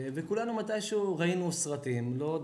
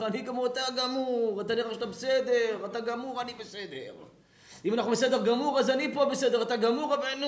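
higher, louder pushy stoner; mock stoner friend; 7 semitones higher, 5 dB louder than usual. Stoned friend urging the rabbi to smoke, higher mock voice. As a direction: urge him to take a hit.

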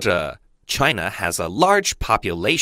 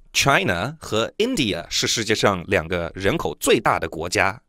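ordinary delivery

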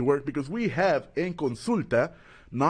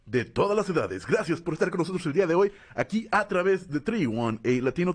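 lucha libre announcer; 7 semitones higher, faster than usual. Acted. Host opens show, announcer style.

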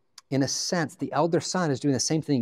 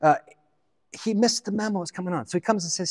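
ordinary talk, nothing marked

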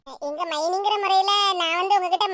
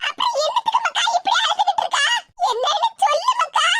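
fretting aachi, voice pitched up and pleading; worried grandmother; 12 semitones higher than usual. Acted. Grandmother fussing over the sobbing girl, high anxious call.

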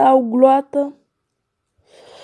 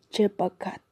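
fading sigh; 5 semitones lower, 11 dB quieter than usual. Acted. Gander's lament fades out.

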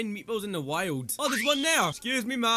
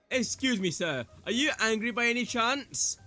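ordinary talk, nothing marked

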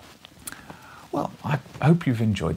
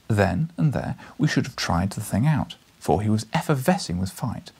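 ordinary delivery, nothing marked

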